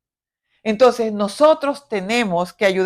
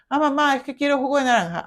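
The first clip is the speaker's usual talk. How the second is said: put-on whiny child; 2 semitones higher, faster than usual. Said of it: "child asking mother".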